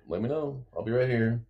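easygoing, half-hearted check-in; laid-back offer. Retold or imitated. Husband imitating himself chilling beside the bed, soft and easy.

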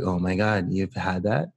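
usual speaking voice

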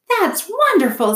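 high, loud burst of gratitude; put-on robot voice, thrilled; delighted robot. A narrator's reading. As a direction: exclaim it with the pitch jumping up.

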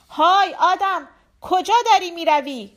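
loud, high challenge to a stranger; sudden shout. A narrator's reading. Barzil challenges a passing rider, sudden shout. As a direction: shout out to halt someone. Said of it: "یک دفعه فریاد کشید".

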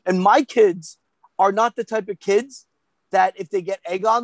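ordinary talk

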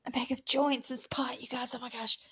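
giggly stage whisper; playful conspiratorial hush; mock-secretive whisper. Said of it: "I had to whisper that".

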